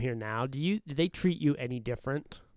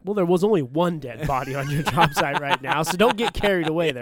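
louder sarcastic pushback at the boss; mock retort; 2 semitones higher, 7 dB louder than usual. Telling the boss not to get carried away, louder and sarcastic. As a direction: snap back at him with mock attitude.

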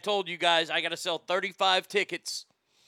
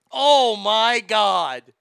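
loud exasperation; 3 semitones higher, 9 dB louder, much slower than usual. Real, not acted. Reacting to [name] video.

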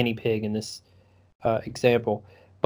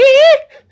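shrill loud burst of a laugh, way higher; high cackle; 14 dB louder than usual. Retold or imitated. Mimicking the kid's unhinged laugh, high cackle. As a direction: cackle wildly before the line. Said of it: "he's like".

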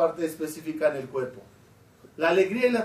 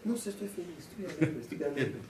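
hushed, much softer acted reply; helplessly happy; 10 dB quieter than usual. Happy man answers the baffled onlooker, softly joyful. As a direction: answer softly, as if joy has no explanation. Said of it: "sentir una alegría inmensa".

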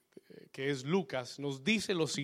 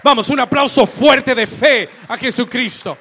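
full-force preaching; 6 semitones higher, 19 dB louder than usual. Real, not acted.

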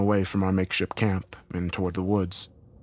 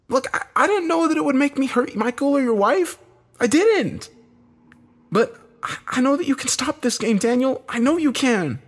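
high, strained, loud plea; frantic defence, pitch way up; desperate pleading; 5 dB louder than usual. A narrator's reading. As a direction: plead your innocence urgently. Desperate pleading defence after being punched.

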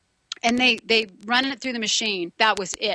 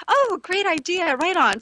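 enthusiastic agreement; 8 semitones higher than usual. Retold or imitated. She agrees with Joe.

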